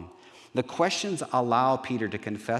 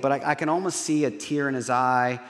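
ordinary talk

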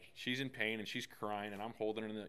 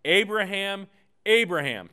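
loud, high call of the name; angel calling; 10 semitones higher, 15 dB louder than usual. Acted. Angel of the Lord calling Abraham to stop, loud. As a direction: call out from heaven, urgent. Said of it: "called to him from heaven and said".

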